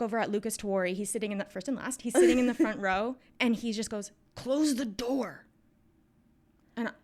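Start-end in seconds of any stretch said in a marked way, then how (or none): 4.3-5.5 very slow, drawn-out hint; imitating a classmate's laboured prompt; pointed retold prompt. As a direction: stretch each word like a heavy hint. Classmate gives child the hint.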